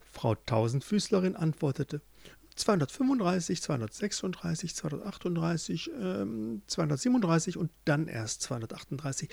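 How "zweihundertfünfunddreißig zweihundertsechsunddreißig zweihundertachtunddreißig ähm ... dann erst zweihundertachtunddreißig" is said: quick, soft counting under the breath; absorbed counting. Acted. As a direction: count rapidly to yourself, losing track. Centipede counting her legs to herself. (schnell und leise vor sich hin zählen)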